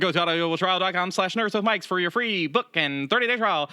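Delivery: rapid, high-pitched auctioneer patter. Shown as a demonstration. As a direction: rattle it off like an auctioneer. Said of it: "you sound like an auctioneer".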